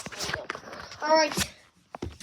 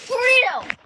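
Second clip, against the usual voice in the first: higher, louder dramatic cry of tornado!; excited shout; 9 semitones higher, 9 dB louder than usual. Real, not acted. Kid filming the storm shouts out the tornado excitedly. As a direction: announce it like a big reveal.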